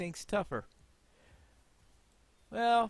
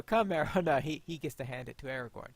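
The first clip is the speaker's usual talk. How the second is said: ordinary talk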